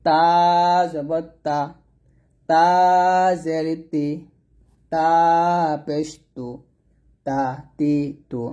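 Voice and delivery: long তা, pause, then joined syllable; slow recitation of তা, তি, তু; tongue at upper tooth roots for তা; tongue-tip Ta. Shown as a demonstration. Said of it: "দাঁতের গোড়ার সঙ্গে লাগিয়ে".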